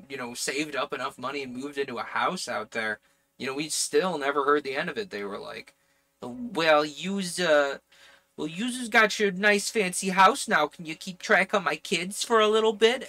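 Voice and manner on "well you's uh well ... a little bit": loud, high put-on Jersey accent; attempted Jersey accent. Relative asking for childcare favors, in a put-on Jersey accent. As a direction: wheedle loudly in a New Jersey accent. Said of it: "I might be able to do a Jersey accent".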